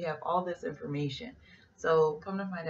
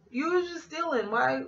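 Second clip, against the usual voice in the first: high, louder sassy accusation; incredulous; 10 semitones higher, 3 dB louder than usual. Retold or imitated. Crew stares at the thief, high sassy accusation. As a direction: call her out in disbelief.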